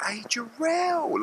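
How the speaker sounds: very high, slow, syrupy fake greeting; two-faced fake friendliness. Mocking two-faced boxing people with a sugary greeting. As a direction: gush like a phony friend. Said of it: "be two-faced and be like".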